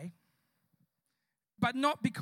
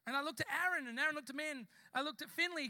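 normal talking voice

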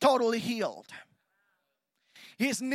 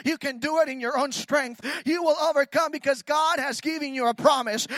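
usual speaking voice

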